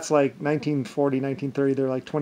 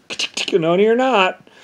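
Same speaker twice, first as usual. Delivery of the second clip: tutting then a high, louder no you're not; mock scolding; 6 semitones higher, 4 dB louder than usual. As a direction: wag a finger in your voice. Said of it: "It's like".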